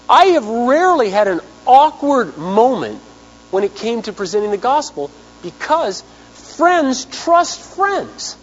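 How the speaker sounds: high, slow emphatic preaching; sincere pulpit emphasis; earnest preaching. Acted. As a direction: stretch each word to press it home.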